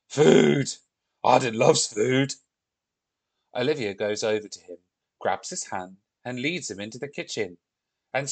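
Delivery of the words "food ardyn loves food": loud, greedy Viking delight; excited Viking. Acted. Hungry Viking told about dinner, loud and eager.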